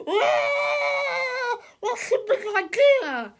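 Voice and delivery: very high, loud shriek; horrified yell. Acted. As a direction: scream at the half-naked stranger. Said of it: "he yelled".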